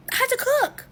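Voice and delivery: exasperated outcry. Retold or imitated. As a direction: cry it out as the last straw. Late, everyone waiting, exasperated.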